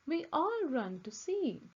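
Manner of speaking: usual speaking voice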